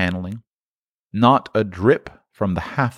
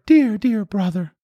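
put-on high, affectionate child's voice; very high, eager little sister; little girl; 14 semitones higher than usual. A narrator's reading. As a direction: greet a beloved brother as a small girl. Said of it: "a little girl, much younger than the boy".

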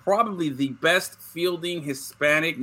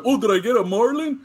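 put-on excited kid; 7 semitones higher than usual. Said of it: "I was just like".

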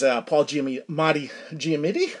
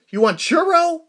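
high, loud drive-thru query; puzzled server; 12 semitones higher, 7 dB louder than usual. Retold or imitated. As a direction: imitate an uncomprehending worker over the order. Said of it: "She kept going".

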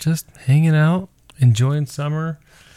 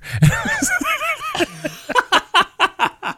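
big laugh. Real, not acted. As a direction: burst of high helpless laughter. Dad recalls daughter's joke.

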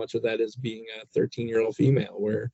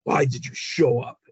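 put-on teen scolding; 3 semitones higher than usual. Daughter scolding dad at home.

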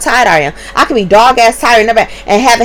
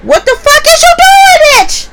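mock incredulous screech; shrieked outrage; 5 dB louder than usual. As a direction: screech it in disbelief. Outraged at the babysitter.

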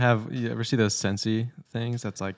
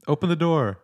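higher, louder demand; Gavin demanding; 7 semitones higher, 4 dB louder than usual. Retold or imitated. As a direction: bang on the door and insist. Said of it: "Gavin knocks on the door".

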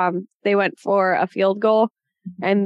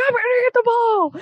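high excited boast. Retold or imitated. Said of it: "he's like".

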